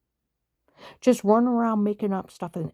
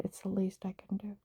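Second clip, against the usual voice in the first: whisper; 9 dB quieter than usual. Retold or imitated. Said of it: "He said".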